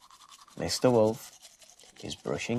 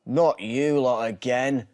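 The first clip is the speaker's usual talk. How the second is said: slow, louder weary groan; 4 semitones higher, 7 dB louder than usual. A narrator's reading. Mister Wolf groaning at the prank-calling pigs. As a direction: groan it out, fed up. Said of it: "groans Mister Wolf".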